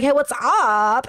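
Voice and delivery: slow camp greeting, UP stretched and lifted; drawled, playful camp hello. Retold or imitated. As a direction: answer in the same put-on camp voice.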